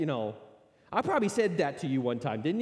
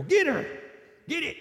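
high, loud joking yell of 'get her'; mock shout; 13 semitones higher, 6 dB louder than usual. Pastor jokingly shouting to send his wife at the bear.

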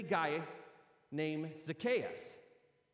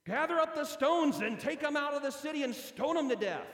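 high, loud condemning command; mock harsh order; 8 semitones higher, 8 dB louder than usual. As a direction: bark it like an angry mob leader. Imagined mob sentence.

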